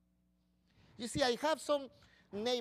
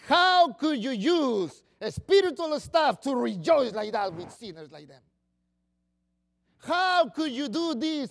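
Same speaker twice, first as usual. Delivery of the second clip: indignant critics; 11 dB louder than usual. Retold or imitated. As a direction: shame them for rejoicing with sinners. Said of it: "they come to you and say".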